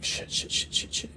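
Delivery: breathless whispered curses; whispered panic. Acted. Harry realizing the girls are in danger, whispered panic. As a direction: hiss the curses under your breath in panic.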